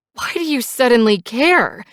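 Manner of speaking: high, loud, angry hissed challenge. A narrator's reading. Passenger challenging biker's sudden concern, angry hiss. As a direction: spit the question back at him. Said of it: "I hiss".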